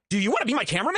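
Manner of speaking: mock high squeaky offer; high-pitched Salvo. Host imitating Salvo's high eager question. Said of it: "And Salvo goes".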